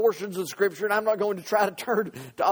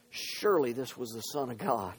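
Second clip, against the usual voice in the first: ordinary delivery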